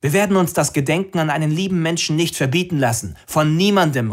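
high, loud defiant proclamation; defiant call. Acted. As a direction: call it out so nobody can stop you. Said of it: "rief Anna".

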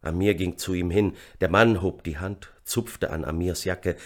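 plain reading voice

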